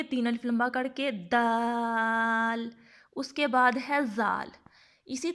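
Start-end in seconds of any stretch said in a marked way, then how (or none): 0.0-2.7 slow, stretched دال held three beats; elongated dal. Shown as a demonstration. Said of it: "اس کو ہم لوگ کیسے پڑھیں گے؟".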